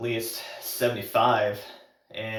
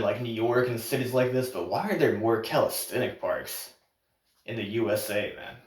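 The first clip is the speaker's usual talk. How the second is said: normal talking voice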